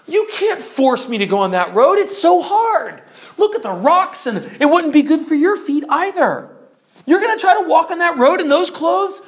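high, loud complaint; acted indignant refusal; whining protest. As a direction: balk and protest at the hard road. Mister World refuses hard road.

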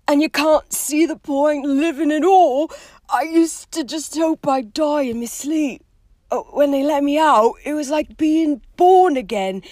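high, loud, rough countryman pouring out memories; distressed, emotional gamekeeper. Acted. Hagrid recalling prison and release, loud and emotional.

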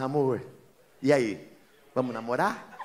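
voz mansa e baixa de conquista; falando manso. Retold or imitated. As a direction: fale manso, puxando conversa com a esposa. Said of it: "falando manso". (marido falando manso com a esposa)

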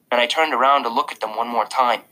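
ordinary delivery, nothing marked

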